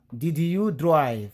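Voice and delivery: "Did you drive?" very slow with "drive" plain present; slow English model. Shown as a demonstration. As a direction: sound out the correct present tense. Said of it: "Faut pas dire drove".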